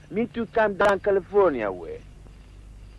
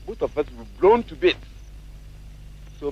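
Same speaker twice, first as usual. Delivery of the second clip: ordinary talk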